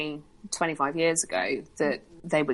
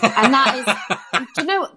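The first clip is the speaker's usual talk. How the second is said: laughing; 7 semitones higher, 5 dB louder than usual. Real, not acted. Laughing after grim remark.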